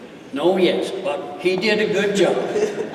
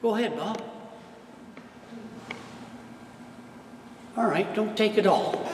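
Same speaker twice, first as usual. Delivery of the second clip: gentle; 13 dB quieter than usual. Acted. Talking gently to his horse.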